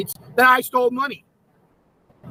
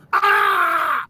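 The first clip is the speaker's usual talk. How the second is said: short pent-up "Ah!" shout, pitched up; frustrated yell; 5 semitones higher than usual. Pretend. Streamer venting at accusers, exasperated yell. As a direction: let out one sharp exasperated yell. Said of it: "I get to yell at".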